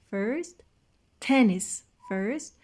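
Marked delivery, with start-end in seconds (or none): 1.2-1.7 "tennis" with a loud, punched TEN; exaggerated stress. Shown as a demonstration. Teacher demonstrating word stress to class: loud TEN in "tennis". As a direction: hit TEN in "tennis" hard.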